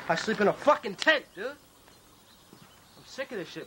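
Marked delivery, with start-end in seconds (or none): 1.5-3.7 breath-only, drawn out slowly and softly; exhausted near-whisper. Acted. Tired of sleeping in a tent, drained whispered complaint. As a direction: let it sink out of you like air leaving a tire. Fed up, muttering to himself.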